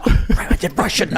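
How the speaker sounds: hammed-up Russian accent; mock Russian accent. Caught stand-in admitting who he is, in a mock Russian accent. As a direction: own up in a fake Russian voice. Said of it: "Why do you sound Russian?".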